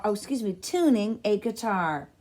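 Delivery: usual speaking voice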